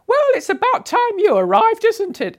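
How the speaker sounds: high, loud Cockney chiding, imitating her; strong Cockney accent. Post office woman greeting the late arrival, loud Cockney scolding. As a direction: belt it out in broad East End Cockney. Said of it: "in a strong Cockney accent".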